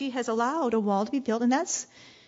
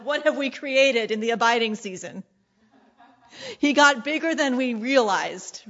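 ordinary talk

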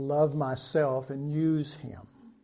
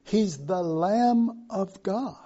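ordinary talk

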